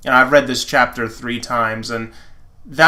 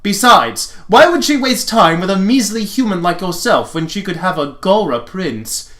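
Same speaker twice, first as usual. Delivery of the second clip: smug prince; 5 semitones higher than usual. Acted. Lotor taunting the human boy, higher smug boast. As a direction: taunt him like a vain royal.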